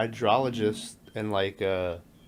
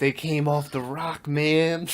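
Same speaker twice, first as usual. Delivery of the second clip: stoner drawl; 4 semitones higher, slower than usual. Retold or imitated. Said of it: "And he's like".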